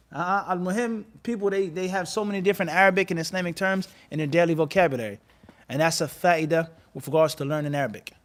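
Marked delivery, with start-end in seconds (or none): none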